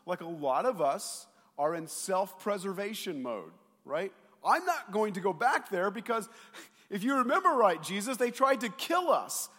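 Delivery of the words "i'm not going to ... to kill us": panicked disciples. Acted. Disciples voiced, self-preservation mode.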